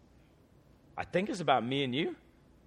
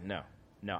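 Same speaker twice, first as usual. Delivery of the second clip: quiet refusal; 9 semitones lower, 13 dB quieter than usual. Retold or imitated. Grieving dad refuses.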